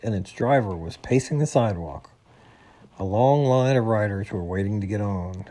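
plain reading voice

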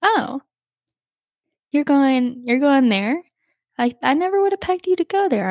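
high "oh", then hesitant, questioning surprise; taken aback. Retold or imitated. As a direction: act out someone thrown by the news. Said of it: "they kinda were taken aback".

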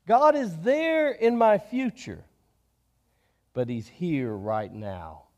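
reach out on there, pull in close on here; there and here contrast. Shown as a demonstration. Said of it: "Stand and just stick your arms out".

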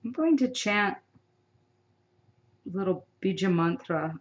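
ordinary talk

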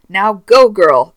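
loud, punchy slogan; exclamation point. Acted. Reading the product's bold instruction aloud, emphatic and loud. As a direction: read it out like bold print. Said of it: "in bold with an exclamation point".

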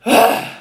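loud exasperated "ugh"; shouted groan. A narrator's reading. Duke thwarted over his cherries, exasperated groan. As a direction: burst out a loud breathy groan. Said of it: "shouted the Duke".

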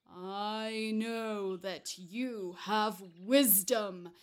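slow, loud rumbling giant, building to a roar; growling giant. Acted. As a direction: growl out the demand, menacing and drawn out. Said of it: "started to speak with his growling voice".

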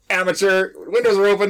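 high, loud retold heckle; called-out jeer. Colleague jeering while driving by, loud mock call. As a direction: mimic a colleague hollering from a passing truck.

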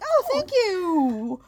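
high, slow, gushing oh thank you; delighted thanks. Retold or imitated. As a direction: gush with naive delight. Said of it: "at first I was like".

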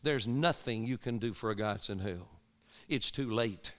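low, hushed, sober retold warning; grave, direct counsel. Preacher retelling his answer to a grieving young man, grave and quiet. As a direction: break hard news gently but firmly, pitched low and soft. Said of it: "I looked him straight in the face".

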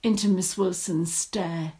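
ordinary delivery, nothing marked

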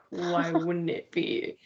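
retold puzzled comeback, drawn out; bemused. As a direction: answer an odd compliment slowly and softly. Retold reply to misplaced compliment.

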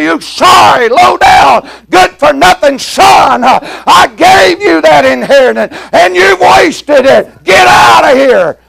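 a scornful father yelling his son out, pitch high; angry father cursing. Retold or imitated. Expected father cursing prodigal son.

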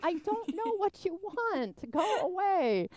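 high-pitched, exasperated pleading with a bird; exasperated, high and drawn-out. Retold or imitated. Exasperated talk to hovering hummingbird.

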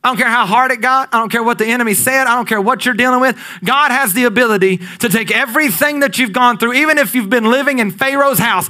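rally the congregation; rising pulpit fervor; preaching at full force. Acted. Sermon climb to full force.

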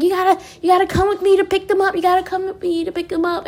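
put-on fast, high, eager kid's urging; excited niece. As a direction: rush it out like a thrilled child. Excited niece voiced by aunt.

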